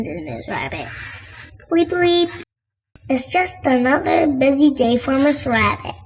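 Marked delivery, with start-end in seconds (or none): 1.7-2.3 high, loud checkout bleep mimicked; scanner bleep. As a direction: copy the till scanner sound.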